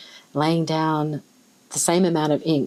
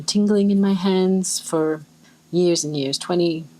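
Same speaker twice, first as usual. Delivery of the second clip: normal talking voice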